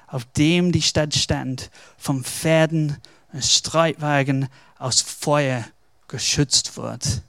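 ordinary talk

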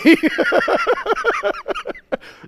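long, high-pitched burst of laughter; loud high laugh. Real, not acted. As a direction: crack up loudly at your own story. Preacher laughing at own story.

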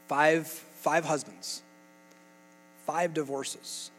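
five said slowly, each five weighed heavy; slow emphasis. Real, not acted. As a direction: drag out five, five husbands for weight. Preacher stresses the count.

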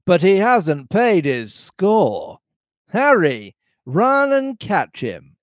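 high, drawn-out pirate orders; Long John Silver crying out. A narrator's reading. Landlord sending a man after the runaway, high calling-out voice. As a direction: call orders across the tavern as the landlord. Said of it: "cried Silver".

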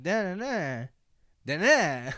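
"Da-na-na, da-na" as rising-and-falling beats, paused between; flowing joke rhythm. Shown as a demonstration. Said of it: "it has that, like, flowing".

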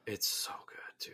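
soft unvoiced whisper. Real, not acted. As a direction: breathe it out in a whisper. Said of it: "whispered aside".

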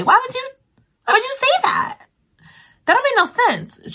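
high, disbelieving why would you; incredulous. Real, not acted. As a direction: ask in total disbelief. Incredulous at character.

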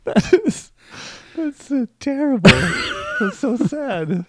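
high, squeaky mock horror; mock dismay. Real, not acted. Host reacting to his co-host's dad joke, high mock horror. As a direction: squeal it like you're wounded by the pun.

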